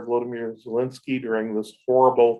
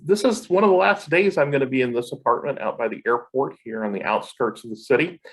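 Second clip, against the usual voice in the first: ordinary talk, nothing marked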